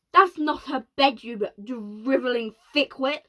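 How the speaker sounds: yelling. Acted. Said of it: "yelled the President".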